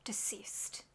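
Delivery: soft whisper so she sleeps on; whisper, someone is sleeping nearby. Real, not acted. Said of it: "being kind of quiet".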